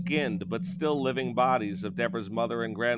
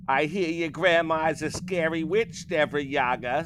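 witch voice; 6 semitones higher than usual. A narrator's reading. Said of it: "says Baba".

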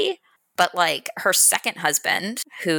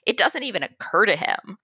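usual speaking voice

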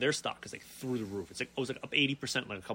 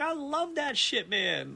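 gushing delight; 11 semitones higher, much slower than usual. Retold or imitated. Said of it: "I'm like".

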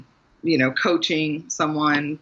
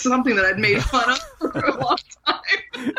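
laughing; 7 semitones higher than usual. Real, not acted. Mocking life coaches, laughing.

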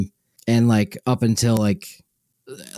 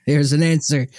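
put-on Southern accent; 5 semitones higher than usual. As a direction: lightly put on Southern accent, imitating a friend. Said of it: "I gave you a Southern accent there".

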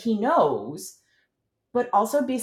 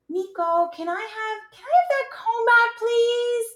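high, sing-song request. Shown as a demonstration. Parent asking child for the comb, high sing-song. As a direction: float it up high and sweet. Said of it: "with a sing-song voice".

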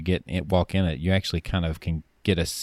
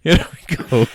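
high, loud Italian plumber voice; Mario impression; 9 semitones higher, 6 dB louder than usual. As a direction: belt it out like Mario. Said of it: "Apparently she became Mario".

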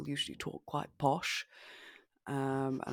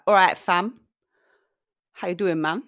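put-on louder London rude boy accent, casual; 3 semitones higher, 9 dB louder than usual. Shown as a demonstration. Showing an American friend a London rude boy accent, louder. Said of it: "a rude boy accent".

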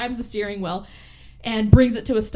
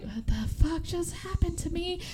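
groggy; 8 semitones higher, 5 dB quieter than usual. Retold or imitated. Waking up kidnapped.

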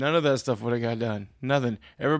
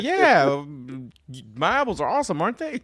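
smug; 2 semitones higher, 5 dB louder than usual. Pretend. Rock star answering a fan, loud smug boast. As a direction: brag like a vain rock star. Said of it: "I'm just like".

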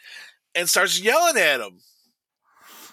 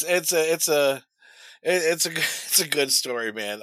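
ordinary delivery

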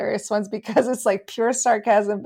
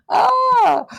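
startled cry; 14 semitones higher, 7 dB louder than usual. Retold or imitated. Reacting to capital ha's.

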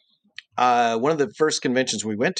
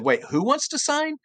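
higher-pitched, suddenly interested question; imitated surprise; 13 semitones higher than usual. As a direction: imitate someone perking up at the news. Cartoonist surprised by the request.

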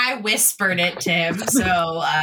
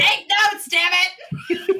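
higher, laughing mock yell; laughing mock scold; 11 semitones higher, slower than usual. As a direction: scold him through laughter. Player scolding friend, laughing.

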